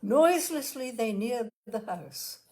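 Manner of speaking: usual speaking voice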